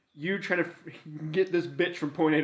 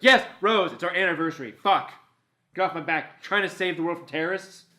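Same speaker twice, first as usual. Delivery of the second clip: raised, mock-fed-up boyfriend; exasperated snapping back; 4 semitones higher, 6 dB louder than usual. Boyfriend answers girlfriend's anniversary quizzing, acted exasperation. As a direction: act worn out by nagging, louder and higher.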